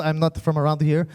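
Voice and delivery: low, smooth mock-French seducer; fake French accent. Shown as a demonstration. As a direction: chat her up in a put-on French accent. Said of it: "say anything with a French accent".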